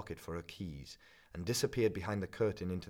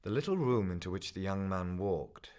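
ordinary delivery

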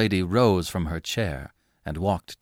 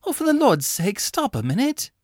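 much higher, exasperated outcry; crying out; 13 semitones higher, 3 dB louder than usual. Acted. Laura cries out to Angus to stop his ceremony. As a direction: throw up your hands and cry out to stop him. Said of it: "she cried".

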